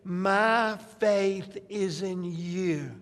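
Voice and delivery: slow, deliberate declaration of trust; measured, understated profession of faith; statement of faith. Acted. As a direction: declare it slowly, word by word. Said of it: "She's just saying".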